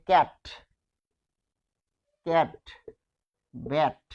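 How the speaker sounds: ক্যাট, ব্যাট with an open æ vowel, as in English cat; open "æ" sound. Shown as a demonstration. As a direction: model ক্যাট and ব্যাট one word at a time, vowel wide open. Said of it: "এই দুইটা উচ্চারণ যখন আসে".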